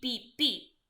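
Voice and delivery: ordinary delivery, nothing marked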